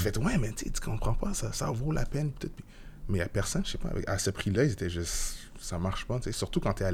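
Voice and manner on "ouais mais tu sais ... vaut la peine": insisting. Retold or imitated. Insisting it is worth it.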